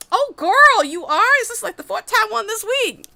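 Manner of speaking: very high, loud disbelieving squeal; shocked girlfriend. Retold or imitated. Girlfriend squealing at the fourth date news. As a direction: shriek it like scandalized gossip.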